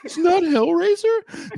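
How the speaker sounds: high, slow, laughing protest; whiny correction. Retold or imitated. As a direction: insist on your real name. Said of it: "I have a name".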